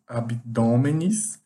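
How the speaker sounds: abdômenes spelled out slowly, syllable by syllable; abdômenes drawn out like a teacher; slow demo word. Shown as a demonstration. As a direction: stretch abdômenes out for the class. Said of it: "adicionando E S".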